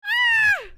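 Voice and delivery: loud, piercing high yell; high scream. Real, not acted. Streamer overwhelmed by gifted subs, screaming. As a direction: let out one short scream.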